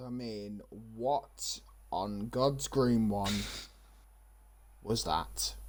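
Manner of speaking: very low, hushed and slow; stunned disbelief. Real, not acted. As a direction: drop right down, dumbfounded. Stunned after the surprise result.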